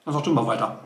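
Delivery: talking with a mouth full. Real, not acted. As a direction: mumble through food. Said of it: "Mit vollem Mund spricht man nicht".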